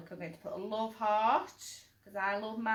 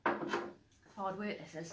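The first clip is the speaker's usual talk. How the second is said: worn out; 4 semitones lower, 6 dB quieter, slower than usual. Real, not acted. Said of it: "I'm going to choke".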